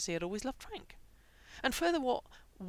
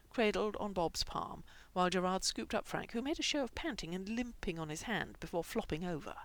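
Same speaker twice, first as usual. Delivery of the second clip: usual speaking voice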